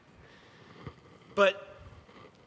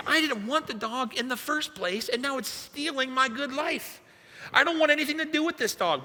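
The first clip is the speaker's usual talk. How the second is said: high-pitched aggrieved complaining; exasperated inner rant; 9 semitones higher than usual. Retold or imitated. Husband losing his good life to a dog, exasperated high rant. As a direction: whine in protest, pitched way up.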